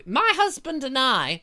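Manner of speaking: put-on high, slow regal old lady voice; lofty mock-royal "my husband and I"; hammed-up elderly queen; Queen impression. Mocking the Queen's speech.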